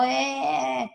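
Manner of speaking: gruff grunt. Shown as a demonstration. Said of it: "te habla golpeado".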